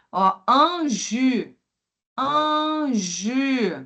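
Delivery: un jus with lips pushed round on the u. Shown as a demonstration. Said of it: "C'est o Y".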